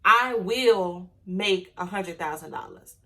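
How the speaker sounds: slow, firm declaration; claiming it. Shown as a demonstration. To her followers, claiming the money slowly and firmly. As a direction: state it like it's already yours. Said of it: "You gotta claim it".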